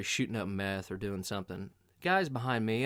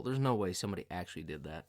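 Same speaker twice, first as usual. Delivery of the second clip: usual speaking voice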